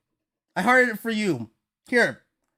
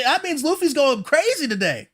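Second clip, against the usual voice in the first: ordinary delivery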